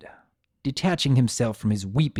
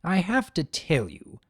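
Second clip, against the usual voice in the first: ordinary delivery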